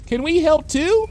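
high-pitched schoolkid voice; small, high child's question; eager child. Acted. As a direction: pipe up like an eager pupil. Schoolchild pipes up.

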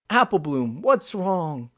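put-on high, loud child's voice, falling ending; concerned young friend. A narrator's reading. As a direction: call to a friend in worried alarm. Young friend asks Applebloom.

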